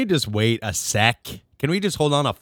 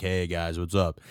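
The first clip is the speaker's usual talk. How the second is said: low, soft mock impression of a friend; subdued, understated greeting in a friend's voice; Scott; 5 semitones lower, 7 dB quieter than usual. Shown as a demonstration. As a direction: drop it low and quiet, lightly imitating a pal. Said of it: "he kinda sounds like this".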